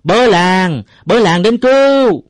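very high, loud, long-held shout; deafening cry for help. A narrator's reading. Uncle Năm ignores the neighbour and keeps raising the village, deafening shouting. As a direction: holler for the village to come running. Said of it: "càng la làng inh ỏi".

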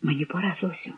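hushed parting words; whispered farewell. A narrator's reading. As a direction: murmur it under your breath, reluctant to be heard. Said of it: "прошепотів неслухняними губами".

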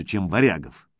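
ordinary delivery, nothing marked

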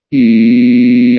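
long, loud wavering wail; acted wail, held long; drawn-out cry. A narrator's reading. Cry of pain in story.

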